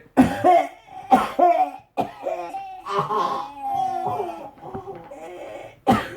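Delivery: high, drawn-out choking coughs; mock choking. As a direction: cough and wheeze like choking on food. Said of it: "and then she went".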